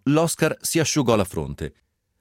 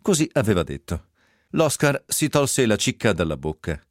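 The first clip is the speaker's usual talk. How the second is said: usual speaking voice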